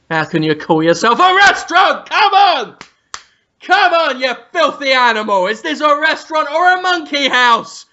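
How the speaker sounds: building to high, loud outrage; hammed-up indignant yelling; mock angry chef rant. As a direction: explode at the staff in mock fury. Acted Ramsay rant after quiet line.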